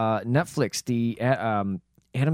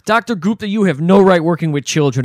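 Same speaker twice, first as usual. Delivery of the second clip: much higher and louder, scolding; mock outraged; 4 semitones higher, 7 dB louder than usual. Co-host voicing Marion scolding the doctor, loudly. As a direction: tell him off like an outraged mother. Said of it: "she's like".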